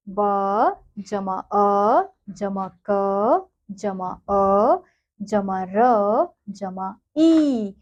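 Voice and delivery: stretched letter by letter; slow spelling out. Shown as a demonstration. Teacher sounding out a word for children, very slow. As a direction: build the word slowly, one sound at a time. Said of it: "ऐसे ही अगला शब्द है बच्चों".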